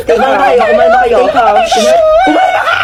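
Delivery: high, loud screams to calm down; shrill frantic shouting; hysterical shout. Acted. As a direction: yell 'calm down' while panicking.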